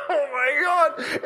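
high, breaking cry of amazement; overwhelmed awe. Retold or imitated. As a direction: gasp it out, completely overcome. Overwhelmed by what Jesus said.

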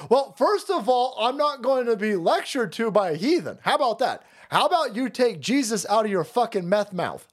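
hammed-up outraged retort; mock angry rant.

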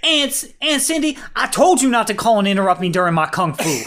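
high, fast exasperated yell. Retold or imitated. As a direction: yell at her, fed up. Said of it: "he's like".

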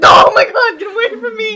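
high, loud mock shriek with laughter; a butterfly dives at her in front of classmates; screaming in fright. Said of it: "and I was like".

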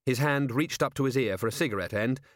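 ordinary delivery, nothing marked

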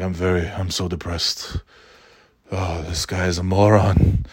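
depressed Ken voice. Retold or imitated. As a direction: mimic a gloomy professor muttering.

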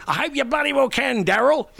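high, loud mock Hawke using the wrong name on purpose; Bob Hawke impression. Hawke calling the reporter Daryl to needle him, imitated high and loud. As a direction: ham up Hawke's huffy retort, pitched well up. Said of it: "he would say".

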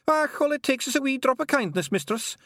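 cheeky wee Scots pixie; Scottish pixie. A narrator's reading. Feegle pixie voiced in story.

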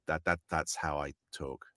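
low, soft, halting answer; deadpan retold reply. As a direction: drop low and quiet, stumbling slightly. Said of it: "I said".

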